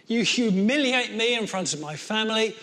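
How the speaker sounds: rising angry tirade, loud and high; furious rant. Acted. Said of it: "And Cain was absolutely furious".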